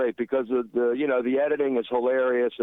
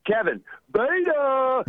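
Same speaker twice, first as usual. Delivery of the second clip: mocking shout; 12 semitones higher, slower than usual. Caller mocking host, drawn out.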